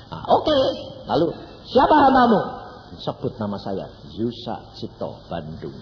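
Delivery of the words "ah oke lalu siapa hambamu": surprised question. Acted. Acted reply to God.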